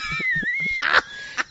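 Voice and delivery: high-pitched burst of laughter; shrieking laugh. Real, not acted. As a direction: howl with laughter. Big laugh at joke.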